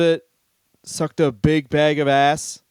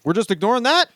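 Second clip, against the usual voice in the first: high, incredulous rant; mock disbelief; 7 semitones higher than usual. Reacting to old Lou Reed lyrics, high mock incredulity. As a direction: squeak it up in outraged disbelief.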